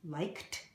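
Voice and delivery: "liked" ending in a clear T; pronounced liked. Shown as a demonstration. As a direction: say "liked" with a final T sound. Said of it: "We pronounce it like a T".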